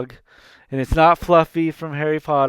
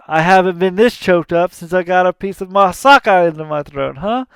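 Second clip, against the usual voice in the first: fast, louder mocking villain voice; Hades joke; 3 semitones higher, 7 dB louder, faster than usual. Hades cracking a joke to the gods, fast and smug. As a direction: rattle off the gag like a smug villain.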